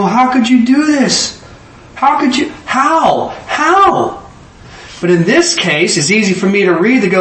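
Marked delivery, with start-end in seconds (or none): 0.0-4.3 rising higher with each anguished how, slower at the start; anguished lament. Retold or imitated. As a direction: plead in disbelief over a wicked son's death. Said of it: "Come look and I go".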